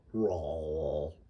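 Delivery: "raw wall" low and soft, open aw; two matching aw sounds in "raw wall"; wrong vowel. Shown as a demonstration. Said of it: "isn't just a W".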